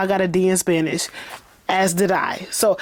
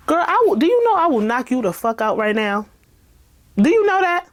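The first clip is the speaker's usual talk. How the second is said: mock threat; 7 semitones higher than usual. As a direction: threaten her with playful fury. Threatening the character mock-angrily.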